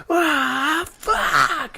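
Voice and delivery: high, long mock scream; imitated scream. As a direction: scream it as if it echoed between buildings. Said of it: "if you scream and you hear".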